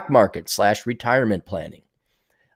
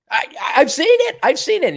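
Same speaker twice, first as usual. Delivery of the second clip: much higher, insistent claim; emphatic. Real, not acted. Speaker insisting to audience he has seen it, high emphatic. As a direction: push it up high, I swear.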